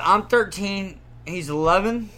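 ordinary talk

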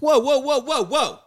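high, rising calls to calm everyone down; imitating a host halting a gunfight; high-pitched whoa. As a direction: pitch way up to stop the standoff. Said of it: "until Candy's like".

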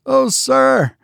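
high alarmed cry. A narrator's reading. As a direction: cry out to stop him. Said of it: "cried Biggs".

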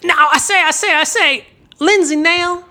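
high, blustering Southern caricature; hammed-up Southern orator, pitched high; mock Southern blowhard. Names called in mock Southern blowhard voice.

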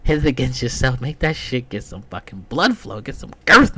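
builds to a loud high shout; coaching himself, ending in a yell; hyped-up pep talk. Retold or imitated. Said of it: "I'm like".

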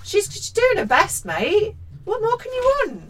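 high-pitched exasperated protest; indignant defence. Acted. Host sticking up for the mum in the book, indignant. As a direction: plead her case in a high, incredulous voice.

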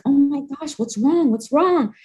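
high, anxious imitation of a dad asking what's wrong; worried father. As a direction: ask urgently like a scared parent. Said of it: "he was like".

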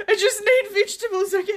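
high, giggling protest; laughing desperate plea. Retold or imitated. Speaker on the floor defending herself to Tony, laughing high plea. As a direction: plead through laughter. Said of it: "It's like".

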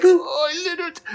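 very high, choked-up mock cry; hammed-up teary squeak; imitated tearful voice. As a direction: act out welling up with relief. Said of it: "tears welling up in my eyes".

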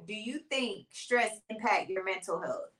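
normal talking voice

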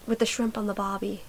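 put-on soft, tossed-off Australian accent; throwaway imitation of an Australian accent; mock Australian accent. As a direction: slip quietly into a fake Australian accent. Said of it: "Like, it makes no sense".

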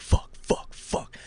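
breathy whispered curses; whispered panic. Retold or imitated. Kid spotting friend's mom awake, whispered panic. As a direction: hiss it under your breath.

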